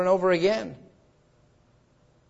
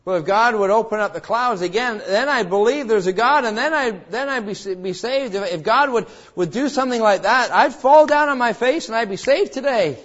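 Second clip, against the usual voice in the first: louder, higher, a preacher's mock of the doubter's demand; doubting unbeliever; 5 semitones higher, 5 dB louder than usual. Preacher mocking the unbeliever who wants proof, loud and raised. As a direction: voice the sceptic who wants a sign.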